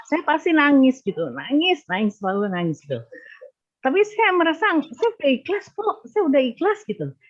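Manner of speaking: fighting back tears while insisting she has made peace; voice breaking. Real, not acted. Widow telling Pak Wayan about her grief, voice breaking. Widow tells of crying in prayer.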